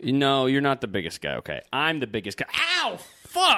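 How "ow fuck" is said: high sharp cry of pain; pained yelp. Real, not acted. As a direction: yelp after getting hit. Hit, crying out in pain.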